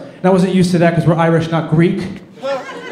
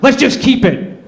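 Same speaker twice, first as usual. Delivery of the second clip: mock scream; 9 semitones higher, slower than usual. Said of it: "screams out".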